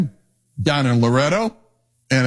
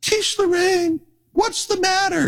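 much higher voice, coaxing the crying goat; imitating his wife. Wife soothing the crying goat at the door, high imitated voice. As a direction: play the wife calling gently to her goat. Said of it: "she just would say".

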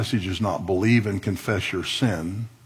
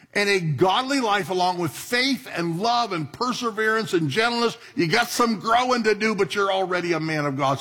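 high impassioned preacher voice; preaching; 9 semitones higher than usual. Acted. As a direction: preach it with fire, high and strong. Preaching Timothy passage at full force.

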